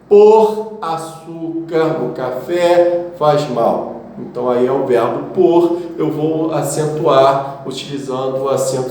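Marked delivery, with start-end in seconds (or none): 0.1-4.0 slow example sentence. Shown as a demonstration. Teacher reading an example for students, slow and clear. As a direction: read out slowly, word by word.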